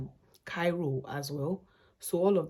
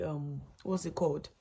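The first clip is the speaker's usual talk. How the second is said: normal talking voice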